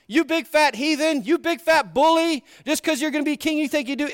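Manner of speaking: high-pitched hammed-up name-calling; mock tirade. Wife imagined insulting the would-be king, indignant mock rant. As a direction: hurl insults in exaggerated outrage. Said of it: "I mean, she didn't do that".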